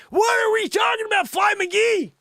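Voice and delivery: high, loud shout at the fly; startled shout. Real, not acted. Host yells at a fly buzzing by his head. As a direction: yell at the pesky fly.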